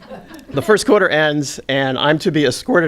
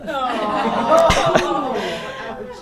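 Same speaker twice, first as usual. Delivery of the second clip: a higher, softer laugh; real laugh; 9 semitones higher, 4 dB quieter than usual. Real, not acted. Teller laughing at the announcer's jab, soft real laugh. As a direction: chuckle at the memory.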